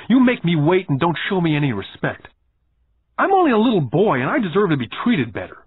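put-on high-pitched accusations from a wronged little boy; acted child's heated tirade; indignant outburst. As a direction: pile up the grievances, worked up. Boy accusing boss in acted quarrel.

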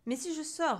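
suddenly severe questioning; stern witch. A narrator's reading. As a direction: check it sternly. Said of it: "reprit-elle sévèrement".

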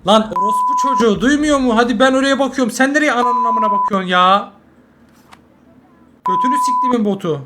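loud furious swearing at teammates; angry yelling. Real, not acted. Gamer raging at useless teammates, loud angry yelling. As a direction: yell it, fed up with the team.

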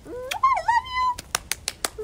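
very high, loud squealed goodbye; excited squealed sign-off; gushing goodbye. Real, not acted. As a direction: shriek out a loving farewell.

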